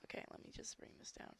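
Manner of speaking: quick hushed whisper under the breath; a real whispered aside, quick. Real, not acted. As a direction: whisper it fast while fiddling with the laptop. Aside while lowering laptop volume.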